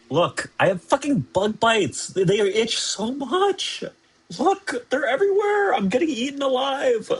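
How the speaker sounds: high-pitched, worked-up whining; whiny complaint. Real, not acted. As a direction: whine it high, desperate about the itching. Complaining about itchy bug bites.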